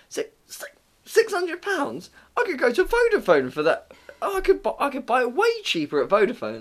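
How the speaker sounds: high-pitched outraged customer; splutter, then squeal at the price; mock incredulous. Customer hearing the phone's price, high mock outrage.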